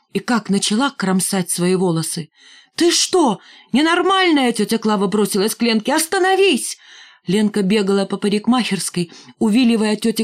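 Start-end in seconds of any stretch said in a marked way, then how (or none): 2.8-4.5 much higher, shocked exclamation; alarmed outcry. A narrator's reading. Aunt lunges to stop girl. 5.9-6.8 high-pitched urgent call to stop; urgent outcry. A narrator's reading. Aunt tries to stop girl.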